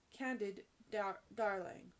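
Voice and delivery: usual speaking voice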